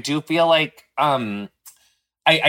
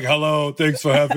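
straight man voice; 2 semitones lower than usual. Pretend. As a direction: flatten out your voice like a macho bro saying hello. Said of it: "that's as close to a straight man voice as I can do".

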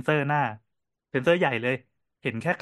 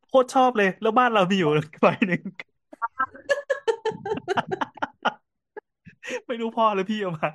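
high, laughing while talking; real laughing delight; 9 semitones higher, 3 dB louder than usual. Real, not acted. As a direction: crack up through the words, pitched high. Delighted, laughing over stolen glass. (พูดไปหัวเราะไปอย่างชอบใจ)

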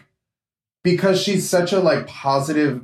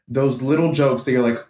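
usual speaking voice

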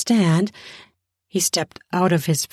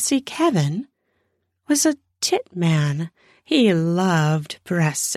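ordinary delivery

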